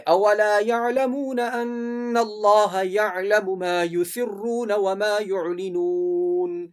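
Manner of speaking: very slow chant with throaty ain in یعلمون; correct ain recitation. Shown as a demonstration. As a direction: hold the vowels, voicing ain in یعلمون. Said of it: "اس طرح پڑھنا ہے". (یعلمون کی عین کے ساتھ ٹھہر کر تلاوت)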